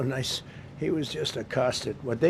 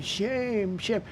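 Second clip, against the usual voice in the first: high, mock chant of shame; imitating the screaming protester, pitched high; mock protester yell; 7 semitones higher than usual. Imitating the protester screaming at police, high mock chant. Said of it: "She's screaming".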